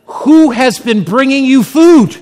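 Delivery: loud, high, slow indignant demand; outraged captain. Acted. Captain demanding answers from his fed prisoner, outraged shout. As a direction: thunder it out like an outraged jailer.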